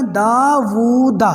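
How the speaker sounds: داوودا with the و stretched out long and very slow; correct long vowel. Shown as a demonstration. استاد طلبہ کو صحیح تلفظ دکھاتے ہوئے، داوودا لمبی واو کے ساتھ. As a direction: draw out the و in داوودا clearly. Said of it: "واؤ کی آواز پراپر نکالنی ہے".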